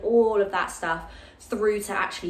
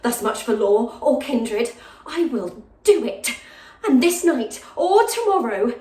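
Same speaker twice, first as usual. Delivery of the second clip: higher, impassioned Shakespearean soliloquy with long dramatic pauses; lovesick jailer's daughter; 5 semitones higher, 6 dB louder than usual. Acted. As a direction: confide a hopeless crush, swelling into louder outbursts. Continues the monologue.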